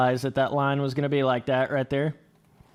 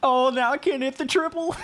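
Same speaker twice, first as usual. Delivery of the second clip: high frustrated shout; 11 semitones higher than usual. Real, not acted. Frustrated at missed line.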